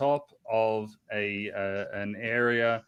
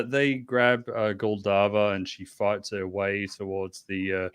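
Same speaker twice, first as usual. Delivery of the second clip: usual speaking voice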